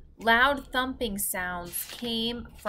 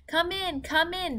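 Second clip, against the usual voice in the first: higher call from the doorway; 9 semitones higher than usual. A narrator's reading. Teacher calls the children in from the storm, hurried call. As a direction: hurry them in out of the rain. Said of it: "Miss Neely called from the doorway".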